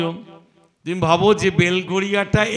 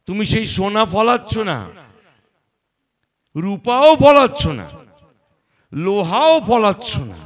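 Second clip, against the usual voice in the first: rising rebuke; 5 semitones higher, 4 dB louder than usual. Acted. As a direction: scold them with growing force. Rebuking listeners, building series.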